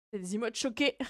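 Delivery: ordinary delivery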